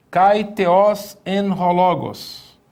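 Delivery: Kai theos en ho logos read slowly and carefully; solemn slow Greek reading. Shown as a demonstration. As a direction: read each Greek word deliberately. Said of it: "Esse texto diz assim".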